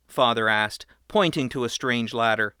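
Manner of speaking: ordinary delivery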